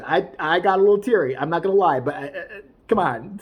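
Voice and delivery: usual speaking voice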